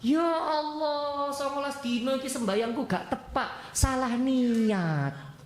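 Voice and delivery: mock weeping prayer, drawn out slowly; acted tearful remorse, stretched vowels; tearful lament. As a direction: wail it slowly in regret, hammed-up.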